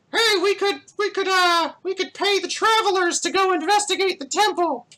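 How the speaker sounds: badly disguise yourself as someone else in the crowd; obvious, very high falsetto disguise; poorly thrown voice. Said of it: "very poorly throws his voice".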